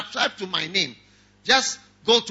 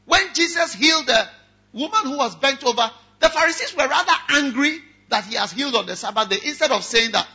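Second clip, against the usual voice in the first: ordinary delivery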